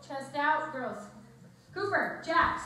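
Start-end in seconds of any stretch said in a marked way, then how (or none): 1.7-2.6 loud, raised scolding call; raised-voice warning by name; calling the dogs. Real, not acted. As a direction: call sharply to misbehaving pets. Calling unruly dogs mid-stretch.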